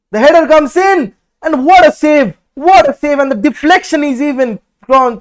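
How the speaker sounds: loud, high burst, easing slightly after the save; excited play-by-play. Real, not acted. Commentator calling header and save, excited shout easing off. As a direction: call the near goal at full excitement. Live commentary on a near goal.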